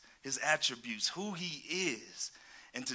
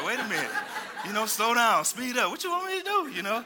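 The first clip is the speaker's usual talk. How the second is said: higher and louder, laughing through the story; excited laughing retelling; 5 semitones higher, 5 dB louder than usual. Real, not acted. Preacher laughing as he tells the congregation about his wife's driving. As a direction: get worked up recalling the wife's panicked driving.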